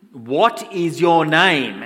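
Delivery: loud, very drawn-out angry demand. Acted. Alexander the Great getting angry at the soldier. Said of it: "he's getting angry".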